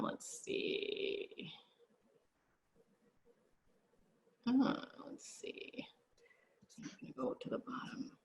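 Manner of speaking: whispering under her breath while searching; hushed aside to herself; murmuring while scrolling down the list; whispered to self. Real, not acted. Host muttering while scrolling questions.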